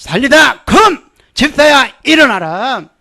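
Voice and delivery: loud, high commanding call; preacher shouting the command; loud proclamation. Shown as a demonstration. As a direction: declare healing boldly. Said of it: "병들어서 누워있으면".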